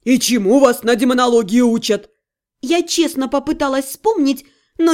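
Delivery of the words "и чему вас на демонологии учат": petulant demon grumble; capricious demon. A narrator's reading. Supreme demon faulting mage's schooling, petulant. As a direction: complain like an offended deity. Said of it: "капризно сказал самый высший демон".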